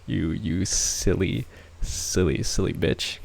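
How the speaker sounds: usual speaking voice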